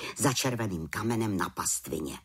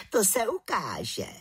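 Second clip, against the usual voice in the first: slow, higher retort; sceptical aunt; 7 semitones higher than usual. Acted. Aunt answers the little witch's challenge, slow sceptical retort. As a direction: draw it out as a veiled threat.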